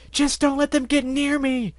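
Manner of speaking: high, slow frightened plea; panicking. Acted. Shop mare pleading as she flees her shop. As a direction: beg in a panic. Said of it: "she was panicking".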